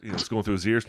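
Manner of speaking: ordinary delivery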